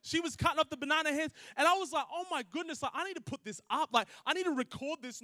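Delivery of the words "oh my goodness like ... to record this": amazed. Retold or imitated. Amazed at her savage cutting.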